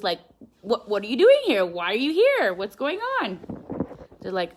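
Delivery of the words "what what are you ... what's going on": loud, high, hammed-up nosiness; mock bursting with questions; eager curiosity. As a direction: imitate overexcited locals pestering a visitor. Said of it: "We're just curious".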